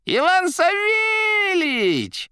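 high, slow joyous cry in a rattling voice; joyful shout. A narrator's reading. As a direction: cry out with delight. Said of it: "радостно вскричала трубка".

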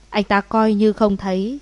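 ordinary delivery, nothing marked

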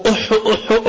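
raspy mock smoker's hacking cough; retold coughing fit; imitated cough.

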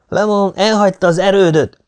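high, loud cry from the crowd; shouted alarm. A narrator's reading. Soldier announcing the major has left, shouting. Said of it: "kiáltotta egy hang".

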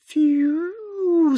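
long high imitated bullet whistle; whistling bullet. A narrator's reading. Narrator sounds out a passing bullet, high whistle. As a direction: whistle like a bullet tearing the air. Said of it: "запела, разрывая воздух, пуля".

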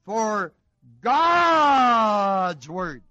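"God's word" shouted out loud; "For God's word" flung out in a loud shout; shouted demo. Shown as a demonstration. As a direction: belt out "For God's word" as the wrong reading. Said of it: "if you leave the genitive out, then it would read".

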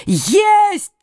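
high, sudden exclamation; triumphant cry. A narrator's reading. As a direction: cry out as the blow lands. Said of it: "крикнул пьемонтец".

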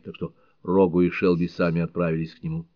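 plain reading voice

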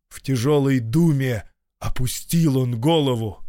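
plain reading voice

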